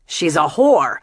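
loud, scornful jab; sneering insult. Acted. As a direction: throw it out with drunken contempt. Jamil insults Francis.

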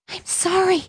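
a startled apology, pitched high; gasped apology. A narrator's reading. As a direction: blurt it out with a little gasp. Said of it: "she gasped".

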